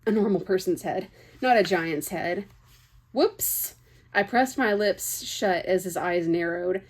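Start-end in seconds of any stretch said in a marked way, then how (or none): 3.1-3.8 a sharp, much higher yelp of alarm; high startled oops; surprised whoops. A narrator's reading. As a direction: catch yourself mid-blunder. Narrator catches her blurt.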